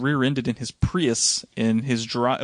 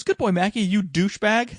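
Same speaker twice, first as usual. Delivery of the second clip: lightly voiced daughter, pitched up; 6 semitones higher than usual. Daughter talking to the family dog, lightly imitated child. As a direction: mimic a child talking to the dog, a bit higher. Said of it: "the daughter goes".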